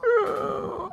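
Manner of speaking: very high, quiet squealing laugh; thin high-pitched giggle trailing off. Real, not acted. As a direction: crack up in a soft squeal. Cracks up after the southern joke.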